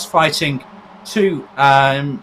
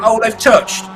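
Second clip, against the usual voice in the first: excited; 8 semitones higher, 6 dB louder, slower than usual. Real, not acted. First call of contact.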